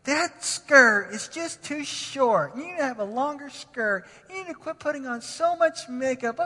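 mock a nitpicking dad; nagging parent. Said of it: "Don't say stuff like".

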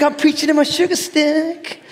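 high, put-on Southern voice; mock Southern preacher. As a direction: play along as a Southern preacher, hammed-up. Plays along in mock Southern voice.

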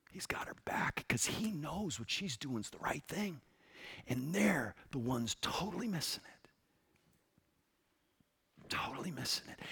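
low, unvoiced confidential whisper; hushed whisper. Real, not acted. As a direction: lean in and breathe the words. Preacher drops to a whisper.

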